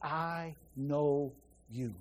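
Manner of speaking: Jesus tenderly telling Mary he knows her; tender, intimate. Shown as a demonstration. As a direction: breathe it like a loving recognition. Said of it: "It's what it says".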